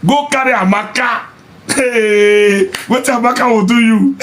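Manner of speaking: very high, drawn-out jeer; gleeful taunt. Real, not acted. Vlogger jeering at men chasing girls, gleeful taunt. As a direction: sing it out high, taunting them. Mock advice, exclaiming at outcome.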